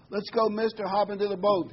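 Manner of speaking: lightly acted rescuer hollering over floodwater; calling rescuer. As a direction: shout him into the boat. Rescue boat crew calling out.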